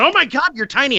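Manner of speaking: high gushing cry; a lighter replay of the gushing squeal; excited squeal. Retold or imitated. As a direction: gush over how small he is. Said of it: "I went".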